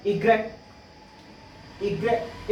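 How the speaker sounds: इग्रेट as ee-grek; French Y. Shown as a demonstration. Teacher naming French Y as इग्रेट. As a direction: say इग्रेट crisply. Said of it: "इसको इग्रेट पढ़ते हैं".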